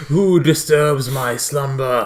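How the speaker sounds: slow, booming ancient-god voice; dusty mummy rasp. Pretend. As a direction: drag out each word like a woken mummy. Mary Poppins as vengeful god.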